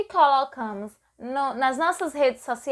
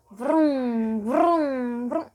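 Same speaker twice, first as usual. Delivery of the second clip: drawn-out vrum like a motor; mock engine noise vrum; engine vroom; much slower than usual. Shown as a demonstration. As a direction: rev it like a motorbike. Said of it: "o som da moto".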